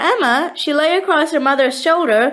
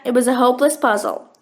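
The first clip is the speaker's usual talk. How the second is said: usual speaking voice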